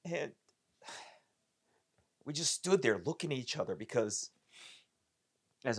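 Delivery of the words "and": plain reading voice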